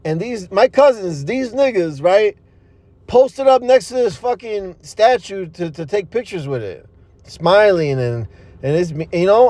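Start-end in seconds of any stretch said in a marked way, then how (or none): none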